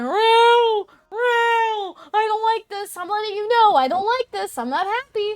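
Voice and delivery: high, wailing yowl of a cat complaining; the cat whining at its owner in a high, crying yowl; whiny cat voice. Retold or imitated. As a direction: cry it out like an upset cat in the bath. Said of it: "he yowls really funny".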